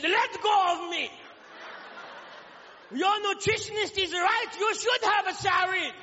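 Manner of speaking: put-on lightly accented chef shouting back; Japanese chef accent. Chef yells at customer in a mock Japanese accent. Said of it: "He said".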